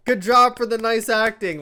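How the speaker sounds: high, loud cheery applause voice; playful crowd impression; mock crowd praise. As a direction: gush like a cheering fan. Imitating the clapping crowd.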